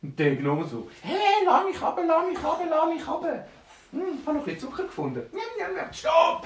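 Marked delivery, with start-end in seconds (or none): 1.0-5.9 very high, squeaky gnome voice; protesting gnome. Acted. Gnome held in the air protests. 5.9-6.4 high, loud acted stop order; sharp command. Dwarf orders gnome to stop.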